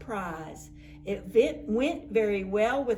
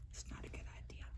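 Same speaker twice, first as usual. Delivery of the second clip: whispered aside; 21 dB quieter, much faster than usual. A narrator's reading. Aside about hot tub.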